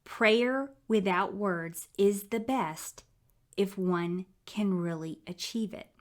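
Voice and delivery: each word said slowly, with weight; slow, deliberate quote. Real, not acted. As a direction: let it sink in, slowly. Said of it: "I'm gonna repeat that".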